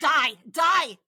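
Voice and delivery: loud mock shout of die; hammed-up yell at sister. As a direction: yell die twice in play. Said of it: "She wished me death".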